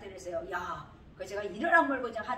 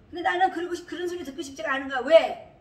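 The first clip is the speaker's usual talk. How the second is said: heated protest; 5 semitones higher, 9 dB louder than usual. Real, not acted.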